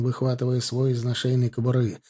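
plain reading voice

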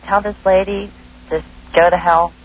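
ordinary delivery

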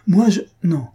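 high, loud broken denial; flustered exclamation. A narrator's reading. Faustine denies her love to Mario, flustered and exclaiming. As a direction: blurt it out, breaking off between words. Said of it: "s'écria Faustine".

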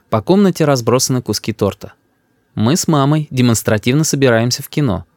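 ordinary delivery

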